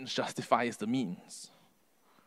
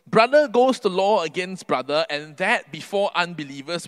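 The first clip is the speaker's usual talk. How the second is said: raised, forceful rebuke in Paul's voice; mildly aggressive, higher and louder scripture quote; aggressive apostle; 3 semitones higher, 7 dB louder than usual. Acted. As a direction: reprove them loudly, as an indignant Paul. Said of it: "Paul's tone gets pretty aggressive here".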